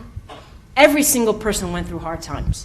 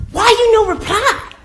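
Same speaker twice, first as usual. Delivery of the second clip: high, louder broken-English question; imitating the lady; 11 semitones higher, 6 dB louder than usual. Massage lady asking the silent praying girl why, higher. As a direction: demand an answer, puzzled. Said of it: "she's like".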